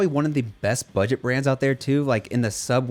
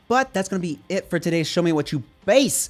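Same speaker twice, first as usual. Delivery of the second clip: ordinary delivery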